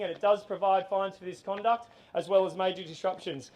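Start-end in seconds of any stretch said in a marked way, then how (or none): none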